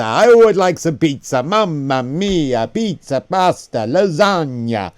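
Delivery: hammed-up mock Italian accent, slow; playful fake Italian accent; jolly Italian pizza chef. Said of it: "If you were to mock someone's accent".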